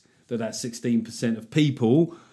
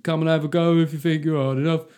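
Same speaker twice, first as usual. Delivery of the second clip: higher, chanted challenge; mock hard-man taunt; 5 semitones higher, 4 dB louder than usual. Britons squaring up to Putin, mock taunt. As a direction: goad him like a rowdy crowd.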